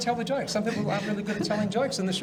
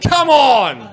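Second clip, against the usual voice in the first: loud exclamation; 6 semitones higher, 13 dB louder than usual. Real, not acted. Host hams up own prop.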